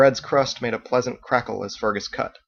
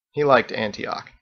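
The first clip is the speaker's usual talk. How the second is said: ordinary delivery, nothing marked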